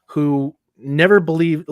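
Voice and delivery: usual speaking voice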